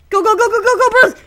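loud, high rapid-fire shouting; frantic urging. Real, not acted. Gamer urging Bruce onward, frantic shouting. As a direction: drive them on with panicked yells.

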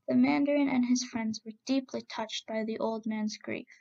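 plain reading voice

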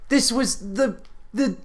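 ordinary talk, nothing marked

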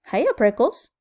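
loud, teasing sing-out; shouted greeting. A narrator's reading. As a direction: call it out in passing. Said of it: "she shouted".

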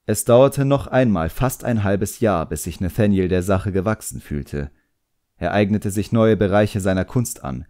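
ordinary delivery

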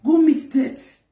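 ordinary talk

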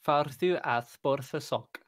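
slow Farðu að borða sock in Icelandic. Shown as a demonstration. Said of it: "a good phrase in Icelandic".

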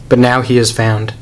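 ordinary talk

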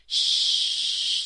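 very loud, drawn-out shush; hushing shh. A narrator's reading. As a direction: hush them all forcefully. Everyone hushes the bears.

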